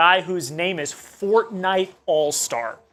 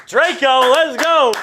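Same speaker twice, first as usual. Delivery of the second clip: higher and louder, cheering a fan; excited hype; 8 semitones higher, 7 dB louder than usual. Real, not acted. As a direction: cheer on Draco's super chat. Hyping a superchat.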